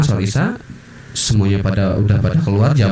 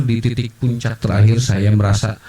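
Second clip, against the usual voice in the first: usual speaking voice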